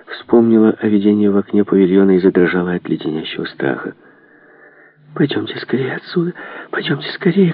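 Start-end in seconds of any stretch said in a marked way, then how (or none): 5.1-7.5 high, hushed, urgent plea; frightened cry. A narrator's reading. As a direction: plead high and breathlessly to get away. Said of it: "крикнула она егерю". (испуганная мольба, высоко и приглушённо)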